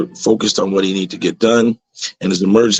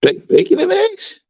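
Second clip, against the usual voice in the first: squealing high, slowed-down disbelief; the pig realises it's his bacon and squeals; shocked pig. Acted. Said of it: "He's like".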